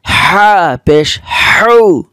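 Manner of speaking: slow, pressed demo of হৌ; pressed throat sound. Shown as a demonstration. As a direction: squeeze হৌ from the middle of the throat. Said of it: "কণ্ঠনালীর মাঝখানে চাপ দিয়ে".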